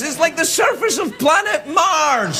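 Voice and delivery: high, slow, laughing half-shout; milk it like a big outrage; hammed-up disbelief; comic exclamation. Exaggerated comic rant.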